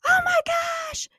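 high-pitched imitated fan gasp; fangirl squeal. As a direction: imitate a starstruck fan shrieking into the phone. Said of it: "starts screaming and crying and freaking out".

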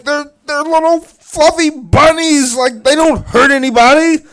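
high, loud, impassioned mock protest; Uncle Bob losing it. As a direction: plead at full volume as the outraged uncle. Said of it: "loses his mind".

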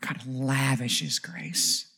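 slow and soft; almost to yourself, very slow; quiet awe; hushed. Acted. Quiet hushed key statement.

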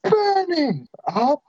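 high, slow, strained crying voice; Nicolas Cage impression. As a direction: wail it like you're on fire. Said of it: "Nicolas Cage from Ghost Rider".

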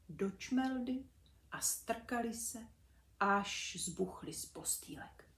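plain reading voice

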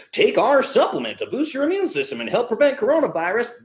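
mocking ad voice. Host mocking supplement companies' ads, high salesy parody. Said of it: "Facebook ads right now saying".

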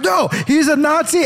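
high shrill-pitched mock outrage; mock outraged liberal. Liberal friends scolding him, mock outraged high voice. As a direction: squeal it like an offended friend. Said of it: "And they were like".

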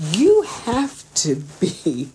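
ordinary talk, nothing marked

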